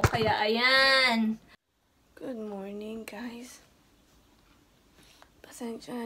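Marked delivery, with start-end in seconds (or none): 2.1-6.0 hushed morning whisper to the camera. Real, not acted. Vlogger greets viewers early, whispering. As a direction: whisper so as not to wake anyone.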